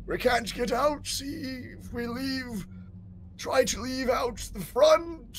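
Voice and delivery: high, slow, moaning undead noble; zombie lord. Acted. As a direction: call out like a lost old zombie lord.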